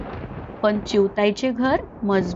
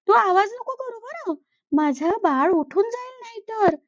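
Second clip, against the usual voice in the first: sympathetic sparrow; 7 semitones higher than usual. Acted. Sparrow pities the cold crow and settles him by the stove, high and caring. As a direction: cluck in sympathy, then fuss over him.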